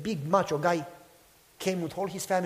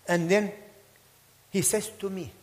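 ordinary delivery

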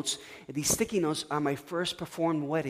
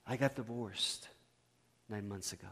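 tearful confession, retold; 5 semitones lower, 9 dB quieter than usual. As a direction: quietly voice a hurting woman, without sobbing. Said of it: "And she starts crying".

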